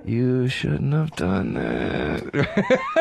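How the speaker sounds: very slow threat with a laugh breaking through; menacing movie villain. Acted. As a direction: stretch it out slowly, trying to sound deadly through a giggle. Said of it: "To just go".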